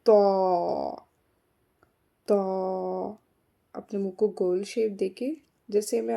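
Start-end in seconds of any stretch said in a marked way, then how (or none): 0.1-3.2 तौ with a thick t and rounded lips; heavy rounded t. Shown as a demonstration. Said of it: "इसको भी मोटा करके".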